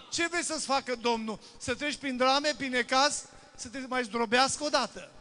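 loud, high, fiery altar call; loud fervent sermon climax; preaching at full force. Acted. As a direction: thunder the call to repent.

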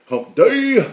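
Hump day squeaked out very high and loud; a silly high-pitched cry of Hump day; squeaky hump day. Pretend.

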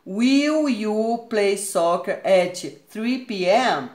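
very slow, careful teacher reading; slow, deliberate classroom question; slow model question. Shown as a demonstration. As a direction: read it out word by word for the class. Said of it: "Olha só, a forma".

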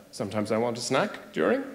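low, soft sheepish aside; quiet guilty mumble; sheepish murmur. Retold or imitated. Sheepish reply in quarrel.

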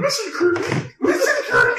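very high, mock-girly; squeaky high girly voice. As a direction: squeak it out like a cartoon girl. Mock girl voice to Kirby.

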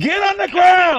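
loud, slow, barked police command; shouting commands. Real, not acted. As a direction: yell it as an order, slow.

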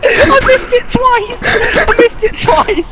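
high, loud, slow words through laughter; crying with laughter; laughing. Real, not acted. Laughing hysterically at own miss.

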